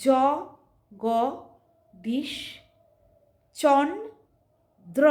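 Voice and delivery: জ, গ, দিশ, চন, দ্র each said apart with pauses; syllable by syllable. Shown as a demonstration. Teacher breaking a name into syllables for students. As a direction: spell out জগদীশচন্দ্র in slow broken syllables. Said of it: "এইরকমভাবে উচ্চারণ করতে হবে".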